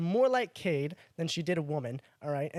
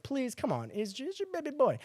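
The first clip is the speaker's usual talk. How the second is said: light mock begging, higher and softer; retold pleading; 7 semitones higher, 4 dB quieter than usual. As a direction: coax a friend's mom, slightly hammed-up. Retold pleading with friend's mother.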